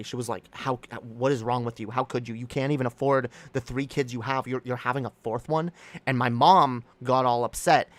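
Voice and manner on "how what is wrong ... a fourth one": ordinary talk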